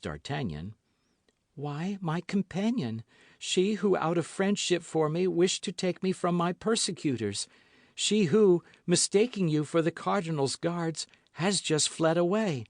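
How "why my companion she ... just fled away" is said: put-on higher young woman's voice, earnest; lightly voiced young woman explaining; Constance voiced. A narrator's reading. Constance explains to D'Artagnan, higher voice.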